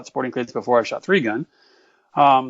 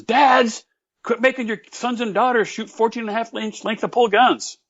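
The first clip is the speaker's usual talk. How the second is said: calling out; 8 semitones higher than usual. Retold or imitated. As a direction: address the dads like a public appeal. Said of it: "I wanna write something that says".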